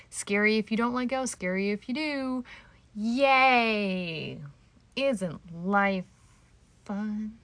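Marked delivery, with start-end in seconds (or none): 2.9-7.4 a mock bright yay, louder, after grim talk; sarcastic cheer. After heavy talk she cheers sarcastically at listeners, mock bright. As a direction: fake the enthusiasm, tongue in cheek.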